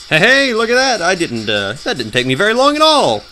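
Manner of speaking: high, loud gleeful exclamation; pleasantly surprised. Real, not acted. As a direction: crow over a quick win. Pleased at quick game progress.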